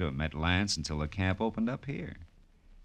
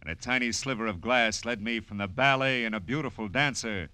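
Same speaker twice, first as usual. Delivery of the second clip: dramatic teaser; 6 semitones higher, 4 dB louder than usual. Acted. Marlowe's dramatic preview of next episode.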